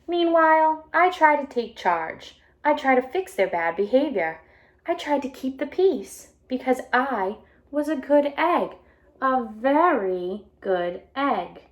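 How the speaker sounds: bright storybook read-aloud voice; animated picture book reading; reading to children. A narrator's reading. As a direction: read it out to a class of kids.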